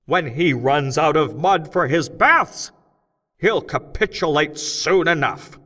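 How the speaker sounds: loud, high, slow speechmaker shouting to a cavern crowd; loud, high, slow rally speech; rousing oration. A narrator's reading. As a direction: whip up the crowd of gnomes, big and deliberate. Crockett's strike speech.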